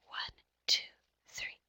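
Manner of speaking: whispered count up to the start number. Shown as a demonstration. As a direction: whisper the numbers, then stop. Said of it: "have children whisper count till we get to our start number".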